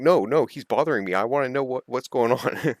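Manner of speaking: the player insists to the umpire, loud and indignant; protesting player. Retold or imitated.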